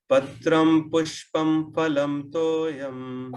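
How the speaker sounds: very slow, word by word; slow recitation. Shown as a demonstration. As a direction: recite each word slowly for memorizing. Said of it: "repeat after me".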